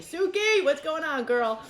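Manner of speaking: high, loud, bright greeting to a viewer; calling out. Real, not acted. Host greeting a viewer named Suki in chat, loud and high. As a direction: sing out a hello to a friend.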